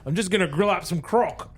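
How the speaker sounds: mock Australian accent. Alien settling in, grinning mock Australian accent. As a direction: play the cartoon Australian. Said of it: "They must be Australian".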